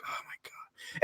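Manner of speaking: barely audible mock-aghast whisper; hushed, acted dismay; whispered disbelief. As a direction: whisper it as if appalled. Aghast reaction to bad film.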